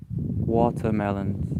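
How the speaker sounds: "watermelons" said slowly, ending on a long "z"; showing a viewer how his final S sounds, slowly; slow S demo. Shown as a demonstration. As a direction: draw out "watermelons" and lean on the final S. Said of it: "pronounce the S at the end of the word watermelons".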